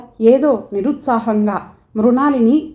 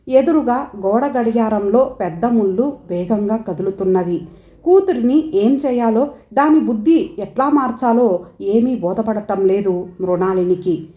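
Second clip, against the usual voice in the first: ordinary delivery, nothing marked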